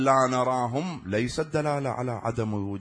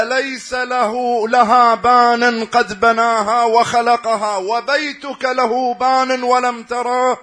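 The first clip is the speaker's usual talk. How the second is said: climbing higher, louder and slower; building rebuke; 9 semitones higher, 12 dB louder, slower than usual. Retold or imitated. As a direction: build to a slow, loud, high climax. Imam's rising rebuke to atheist. (توبيخ يتصاعد عالياً وببطء)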